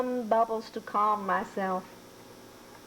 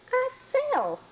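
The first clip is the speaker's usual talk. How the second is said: high indignant protest. Acted.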